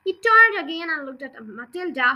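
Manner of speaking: ordinary delivery, nothing marked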